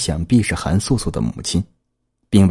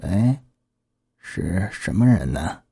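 put-on soft, slow hoarse old woman; 4 dB quieter, slower than usual. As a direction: rasp it out like a frail old lady. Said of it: "是个沙哑的老妇人的声音".

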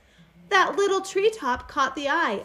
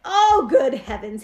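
slow, louder dismayed exclamation; a mild storybook gasp of alarm; shocked gasp; 8 dB louder than usual. A narrator's reading. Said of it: "he gasped".

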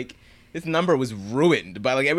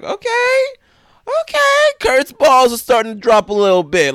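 much higher and louder, thrilled yelp; excited reaction; 9 dB louder than usual. Retold or imitated. A fan hyped by Kurt's grungy singing, squealing. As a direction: squeal at the rocker Kurt. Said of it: "I'm like".

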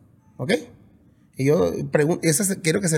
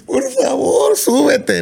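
much higher, louder mock pleading; begging promoters; 10 semitones higher, 6 dB louder, much slower than usual. Singer imitating promoters begging him back on stage. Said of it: "ahí vienen los empresarios".

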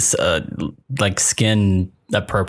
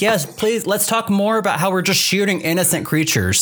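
sarcastic; 9 semitones higher than usual. Retold or imitated. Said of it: "And I was like".

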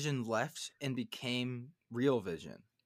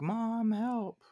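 imitated child's yell; 10 semitones higher than usual. Kids yelling to their mom from afar, mock high call. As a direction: imitate kids calling out, pitched way up. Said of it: "And they're yelling".

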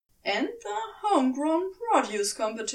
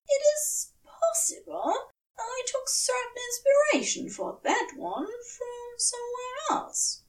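much higher, flustered angel voice, softening; embarrassed confession, pitched way up; Aziraphale; 12 semitones higher than usual. A narrator's reading. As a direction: Aziraphale justifying his blessings to Crowley, high and earnest.

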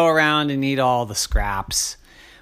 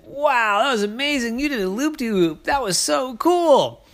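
much higher, wowed praise; amazed hawk; 8 semitones higher than usual. Acted. Hawk wowed by Ike's loop-de-loop. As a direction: marvel at the trick.

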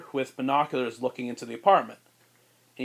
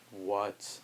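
stunned; 6 semitones lower, 9 dB quieter than usual. Acted. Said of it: "hanging jaws".

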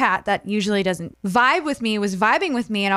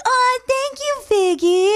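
much higher sugary voice to the cat; sweet baby talk. Retold or imitated. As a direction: coo a thank-you to a pet. Thanking cat for mouse.

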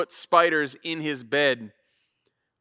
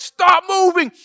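much higher, louder sharp command; dad yelling; 11 semitones higher, 7 dB louder, slower than usual. Retold or imitated. Dad yelling at son to stop moving on the board. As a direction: yell it at a kid who won't keep still. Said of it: "I'm looking, I'm like".

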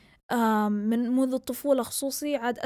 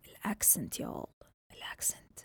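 much softer, almost whispered awe; hushed amazement; 10 dB quieter than usual. Real, not acted. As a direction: drop to a breath, marvelling at the accent. Amazed by a friend's accent.

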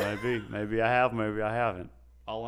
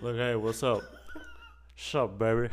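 smooth joking robot voice; a slick robot with a touch of [name]. Pretend. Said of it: "What voice is that?".

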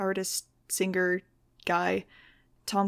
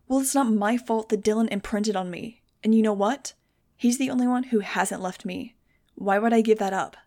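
spitting fury; 5 dB louder than usual. Acted. Max lashing out at Fang in midair, spitting fury. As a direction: spit the words at him. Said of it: "I was practically spitting".

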